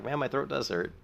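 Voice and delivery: normal talking voice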